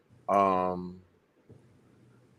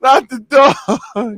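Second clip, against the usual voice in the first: very slow, high, loud wail; anguished cry; 10 semitones higher, 7 dB louder, much slower than usual. Retold or imitated. Repeating the dog movie line, wailing slowly in mock grief. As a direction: drag out the plea in mock grief. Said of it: "I'm like".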